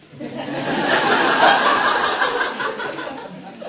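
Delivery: breathy burst of helpless laughter, pitched high; can't hold it in, laugh out loud; real laugh breaking out. Real, not acted. Reader breaks up mid-scene.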